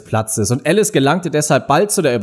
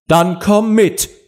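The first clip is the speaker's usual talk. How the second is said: high, drawn-out command; roaring queen; 8 semitones higher, 3 dB louder, much slower than usual. A narrator's reading. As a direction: bellow it slowly as a queen. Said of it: "brüllte die Königin". (brüllende Königin)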